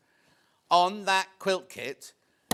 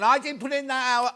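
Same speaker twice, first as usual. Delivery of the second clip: mock defensive colleague; 6 semitones higher, 7 dB louder than usual. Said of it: "he's defending himself now. Going".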